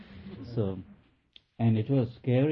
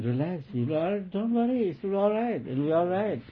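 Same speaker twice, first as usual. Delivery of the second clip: reassuring granny; 9 semitones higher than usual. Retold or imitated. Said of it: "she was saying".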